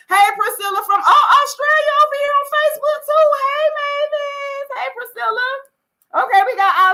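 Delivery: high happy shout-out; excited greeting. Real, not acted. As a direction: call out to viewers with delight.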